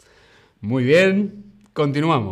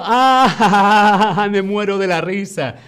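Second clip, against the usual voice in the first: laughing; 7 semitones higher, 6 dB louder than usual. Acted. Acting out laughing at comedy.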